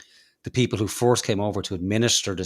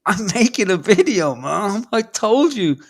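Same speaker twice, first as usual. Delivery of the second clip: high, loud complaining shout; whiny yell at mom; 12 semitones higher, 6 dB louder, slower than usual. Retold or imitated. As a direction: yell to mom over the noise. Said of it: "he wants to scream".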